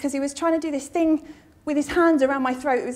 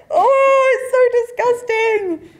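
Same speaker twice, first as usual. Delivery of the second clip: loud, high squeal of revulsion; disgusted outcry; 8 semitones higher, 8 dB louder, much slower than usual. Retold or imitated. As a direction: cry out in drawn-out, high disgust. Acted reaction to unwanted photo.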